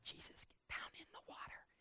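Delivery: hissed whisper, no voice; mother whispering to her son in the water; urgent whisper. Acted. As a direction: whisper fiercely so no one notices. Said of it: "Mary's calling out".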